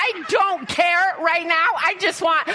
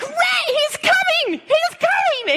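panicked shout; 12 semitones higher than usual. Retold or imitated. Mom yells to her son as the bull charges, panicked. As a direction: yell it like the bull is right behind him.

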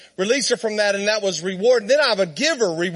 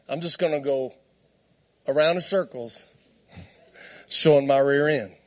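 a hint of an unbothered horse, pitched down; indifferent horse; 7 semitones lower than usual. Horse brushing off its frustrated trainer, low and indifferent. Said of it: "She's like".

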